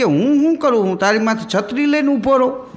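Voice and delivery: a touch of a mocking employer; mock boss retort. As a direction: throw the question back like a scoffing boss. Boss retorts to a complaining servant.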